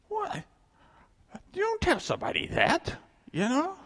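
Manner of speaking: shocked high-pitched What; sudden disbelief, way up high; incredulous. Real, not acted. Outraged reaction.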